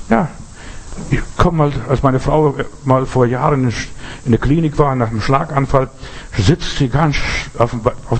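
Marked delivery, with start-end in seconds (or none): none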